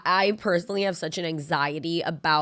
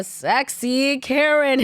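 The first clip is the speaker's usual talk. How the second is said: slow, drawn-out higher announcer call; sultry stage announcer; 8 semitones higher, 5 dB louder, much slower than usual. Retold or imitated. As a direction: stretch the name out like a show emcee. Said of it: "They go".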